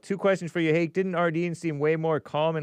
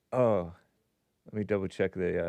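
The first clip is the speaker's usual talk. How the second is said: ordinary talk, nothing marked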